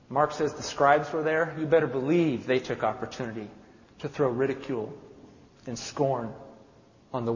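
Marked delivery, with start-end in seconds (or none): none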